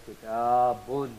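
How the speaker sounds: kitabun drawn out slowly with a rising end; slow model pronunciation. Shown as a demonstration. Teacher slowly demonstrating the sakin noon in kitabun. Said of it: "we will pronounce the sakin noon".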